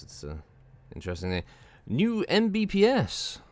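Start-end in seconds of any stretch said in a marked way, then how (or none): 1.9-3.4 goofy voice. Acted. Silly put-on voice mid-game.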